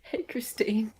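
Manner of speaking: soft unvoiced aside; whisper. Real, not acted. Co-host whispering a greeting to a visitor. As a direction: whisper a quick hello.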